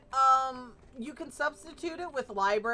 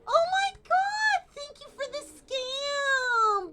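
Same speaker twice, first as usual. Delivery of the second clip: mock gushing; 4 dB louder, slower than usual. Sarcastic thanks for a scam.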